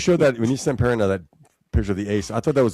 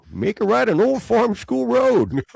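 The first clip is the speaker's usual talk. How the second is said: put-on high, slow old-timer drawl; hammed-up crusty gold miner; old prospector; 11 semitones higher, slower than usual. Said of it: "How about the old Prospector guy?".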